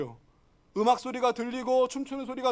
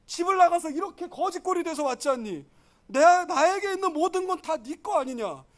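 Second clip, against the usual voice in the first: pleading father; 4 semitones higher than usual. Acted. Father reasoning with his resentful son, pleading. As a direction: plead with your son, raised pitch.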